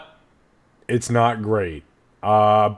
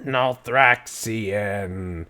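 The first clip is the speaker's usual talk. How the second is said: drawn-out, slow creepy word; deeper, creepier voice; much slower than usual. Acted. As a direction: let the word crawl slowly, deep and creepy. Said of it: "as she says it".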